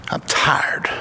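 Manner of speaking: put-on breathy whisper of a worn-out old man; weary whisper. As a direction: let it fade into a tired whisper. Dying uncle quoted.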